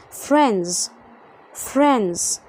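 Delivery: FRIENDS said as "frenz"; silent D. Shown as a demonstration. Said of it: "ما بنطقش الدي". (نطق friends من غير صوت الدي)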